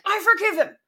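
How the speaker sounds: high mock-earnest declaration; acted, over-insistent forgiving; forced forgiveness. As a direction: push it out high, as if by sheer willpower. Mimics forgiving by willpower alone.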